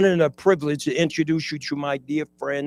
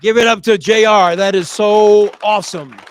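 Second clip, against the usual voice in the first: loud, high cheer for the speaker; excited shout; 5 semitones higher, 13 dB louder than usual. Real, not acted. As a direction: hype up the room. Host calls for applause.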